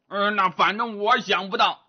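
pig-man voice, grunting between phrases; grunting Zhu Bajie, grumbling. Acted. Bajie asks how they get past the locked door, grunting grumble. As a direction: grumble and snort like a doubting pig.

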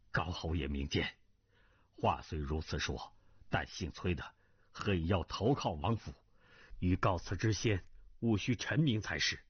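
low, deferential request; humble, guarded retainer. Acted. Cui asking the marquis for a private word, low and deferential.

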